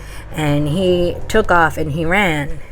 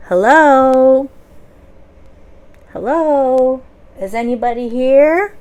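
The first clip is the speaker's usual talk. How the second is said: calling out; 5 semitones higher, 6 dB louder than usual. Acted. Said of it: "he says again".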